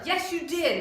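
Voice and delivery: a hint of an angry teacher insisting; imitating a yelling teacher. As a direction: replay the teacher snapping back at her. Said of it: "she's yelling at me".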